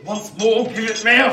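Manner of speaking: loud, high impatient order; harsh demand. Acted. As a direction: snap for the answer again. Convict repeats the demand.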